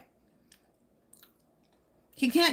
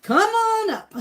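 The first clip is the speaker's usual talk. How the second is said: high "come on" swooping down on "up"; high calling invitation; 10 semitones higher, 4 dB louder, slower than usual. Pretend. Streamer correcting the invitation, high playful call.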